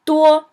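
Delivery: duo held flat and high; teacher showing the high flat tone; first tone duo. Shown as a demonstration. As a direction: keep duo level and high. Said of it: "flat, high tone".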